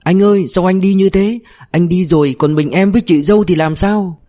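acted high-pitched grieving wail. A narrator's reading. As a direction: wail like a bereaved younger brother. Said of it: "thanh niên chạy vào gào khóc". (giả giọng gào khóc thảm thiết)

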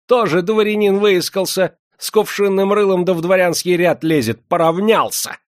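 very high, loud jeering; bilious scorn. A narrator's reading. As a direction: jeer at the upstart with venom. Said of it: "разразился жёлчным смехом". (жёлчная, визгливая издёвка)

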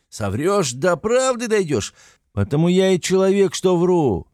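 high, heated tirade; raising his voice. A narrator's reading. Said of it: "ещё более возвышая голос".

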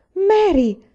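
high, loud shriek of surprise; startled high cry. A narrator's reading. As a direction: shriek her sister's name. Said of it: "strillò Laura".

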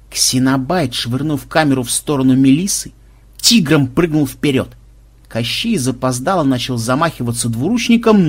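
ordinary delivery

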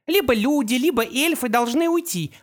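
high, cold villainess; Opal voice. A narrator's reading. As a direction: state it with calm arrogance.

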